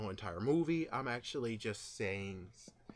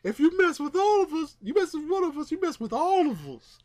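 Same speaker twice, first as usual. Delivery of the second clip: much higher, louder defiant crowd yell; hammed-up rallying yell; crowd shout; 11 dB louder than usual. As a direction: shout it like New Yorkers defying a villain. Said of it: "Green Goblin's like".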